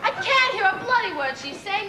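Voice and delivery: high, exasperated complaint; irritated outburst. Acted. First wife unable to hear rival, irritated outburst. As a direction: burst out high, then come down.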